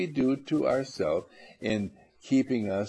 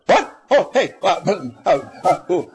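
louder, higher flustered stammer; mock excited stammer; 5 semitones higher, 8 dB louder than usual. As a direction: sputter like you just won millions. Patient hears of lottery win.

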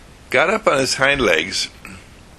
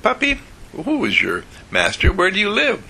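higher, coaxing question to a puppy; asking the puppy; 10 semitones higher than usual. Acted. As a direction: ask the stray kindly. Talking gently to stray puppy.

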